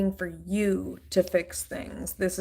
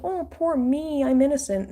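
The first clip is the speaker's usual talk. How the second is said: mock self-pity; 7 semitones higher, 4 dB louder than usual. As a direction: whine it up, feigning innocence. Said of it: "try to make it seem like".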